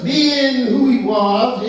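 ordinary talk

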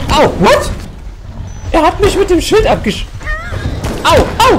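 squeaky high, loud shock; shocked yelp. Real, not acted. Player hit by the monster's shield, high startled yelp. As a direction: voice shoots up in startled disbelief.

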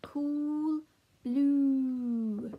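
"cool" and "blue" with long, loud vowels; slow, projected "cool", "blue" for learners; stretched "oo". Shown as a demonstration. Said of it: "we're gonna be looking at".

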